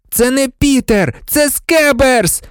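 high-pitched protesting cry; shouting. A narrator's reading. Ron defends his pet rat to Lupin, shouting. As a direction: cry out high, stretching the final word. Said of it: "закричав Рон".

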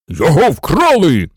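loud, alarmed general's cry; agitated exclamation. A narrator's reading. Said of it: "схвильовано вигукнув генерал". (схвильований гучний вигук)

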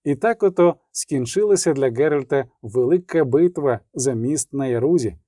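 ordinary talk, nothing marked

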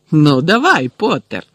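cool dare with a loud start; calm challenge. A narrator's reading. Malfoy draws his wand on Potter, challenging. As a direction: invite the rival to try. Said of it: "спокійно сказав Мелфой".

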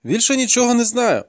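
high-pitched denial; pleading captive boy. A narrator's reading. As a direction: protest in a high boy's voice. Captive boy denying under interrogation.

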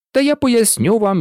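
usual speaking voice

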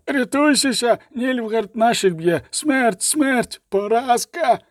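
very high, frantic soldier's wail; roaring panic. A narrator's reading. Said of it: "ричав солдат". (панічний зойк високим голосом)

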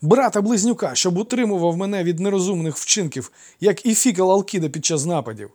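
loud, high-pitched exclamation; noisy, high-voiced clamour; noisy trickster. A narrator's reading. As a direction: call it out boisterously. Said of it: "галасливим вигадником".

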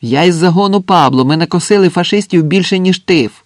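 loud, high-pitched indignant protest; shouted protest. A narrator's reading. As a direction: shout your defence across the line. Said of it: "крикнув Андрес".

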